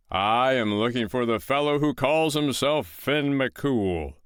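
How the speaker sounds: roaring giant. Acted. Giant roaring at the foster mother as he arrives. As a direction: bellow it slowly like a giant filling the doorway. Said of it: "roared the giant".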